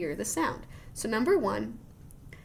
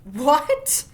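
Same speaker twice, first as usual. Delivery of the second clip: loud, higher what; surprised what; 6 semitones higher, 10 dB louder than usual. Real, not acted. Teacher amazed by the whale intelligence claim. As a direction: blurt it out in disbelief.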